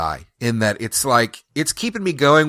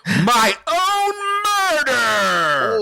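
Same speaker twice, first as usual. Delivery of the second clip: dramatic shout, much higher and slow; noir detective; 11 semitones higher, much slower than usual. Pretend. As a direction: cry out the reveal slowly.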